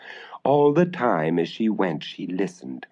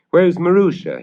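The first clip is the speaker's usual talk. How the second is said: worried father; 9 semitones higher, 6 dB louder than usual. Acted. Father asks for daughter.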